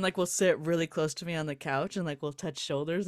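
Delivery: ordinary delivery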